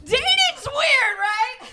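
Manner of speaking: dating's weird, said high and very slow; high drawn-out delivery. Acted. Comic to the crowd, a high, slow opening line. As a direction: stretch it out way up high like a bold claim.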